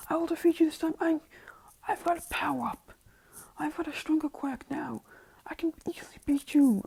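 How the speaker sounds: strained, whispery rival's voice; Bakugo's challenge. Acted. Bakugo challenges Midoriya in the ring, in a strained whispery voice.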